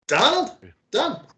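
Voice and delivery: high calling voice from upstairs; anxious high call of a name. Acted. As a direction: call down the stairs for a friend. GM voices friend calling from above.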